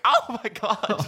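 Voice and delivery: high, drawn-out mock shock; imitated horror. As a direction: stretch it out in horrified imitation. Housemate's horrified reaction, retold.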